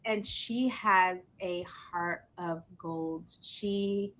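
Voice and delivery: low, soft, sincere admiration; heartfelt praise. Real, not acted. Host praises her friend's heart of gold, tender and soft. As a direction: say it tenderly, almost to yourself.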